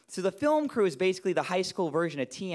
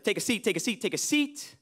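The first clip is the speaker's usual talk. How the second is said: hurried instructor voice; 5 semitones higher than usual. Retold or imitated. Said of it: "kind of goes like this".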